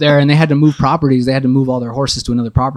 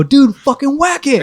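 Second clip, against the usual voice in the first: high, drawn-out mock order; exasperated acted yell; 11 semitones higher, 4 dB louder, slower than usual. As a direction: holler it slowly, pitched way up. Frustrated men escalate their order.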